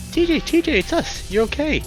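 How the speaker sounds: high, loud panicked calling; urgent shout. Acted. Friend calling to panicking T J, urgent shout. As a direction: yell to get through to him.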